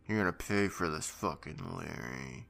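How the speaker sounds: low, soft, slowing threat; mock menace, trailing down softly; menacing. Co-host imitating friends threatening Larry, quietly menacing. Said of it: "all menacing like".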